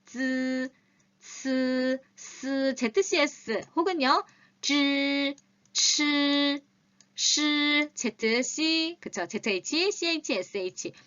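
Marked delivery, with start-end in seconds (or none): 0.1-9.0 쯔, 스, 즈, 츠 held as buzzing hisses; each sibilant 쯔, 스, 즈, 츠 held steady; teacher models the sounds one by one; Chinese sibilant demo. Shown as a demonstration. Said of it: "으라고 날 때는 어떻게 해요?".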